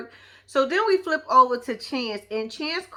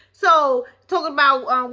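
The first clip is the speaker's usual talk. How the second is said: normal talking voice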